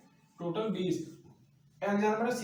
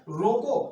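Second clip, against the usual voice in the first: रोको louder, set off alone; comma stop on रोको; 5 dB louder, much slower than usual. Shown as a demonstration. Teacher reads the second sentence to students, stressed रोको. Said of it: "अल्पविराम चिन्ह लगा दिया".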